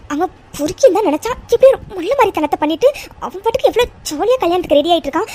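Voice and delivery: high-pitched, indignant rant; outraged. Acted. Friend cursing the cheating groom, outraged. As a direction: rail against him in disgust.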